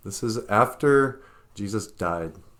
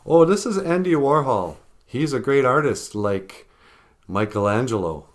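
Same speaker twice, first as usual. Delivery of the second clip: mock lecturing; 4 semitones higher, 6 dB louder than usual. Mocking what the education system says.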